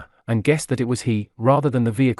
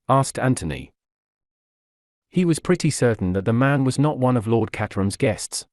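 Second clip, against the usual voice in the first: usual speaking voice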